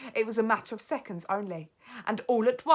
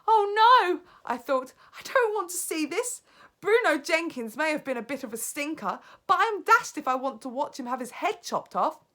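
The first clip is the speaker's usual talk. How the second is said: horrified thought; 9 semitones higher, 10 dB louder than usual. Acted.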